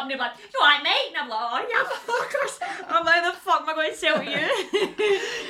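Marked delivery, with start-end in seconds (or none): 0.5-1.1 high, loud, put-on matey hello; exaggerated cheery greeting; mock customer greeting. Imitates the non-Scottish customer's greeting. 1.4-5.5 mock dismay. Acts out dismay at non-Scottish customers.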